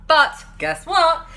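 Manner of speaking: high, slow playful guess what; playful storyteller tease; teasing build-up. A narrator's reading. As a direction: tease the kids before the punchline.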